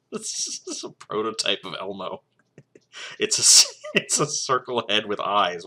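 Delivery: words breaking into giggles; laughing. Real, not acted. Reader mocking Elmo prototype to listeners, laughing. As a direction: talk through suppressed laughter. Laughing while reading absurd story.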